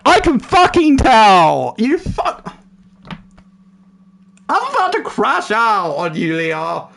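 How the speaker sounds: loud high fake fury; mock outburst. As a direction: blow up in jest.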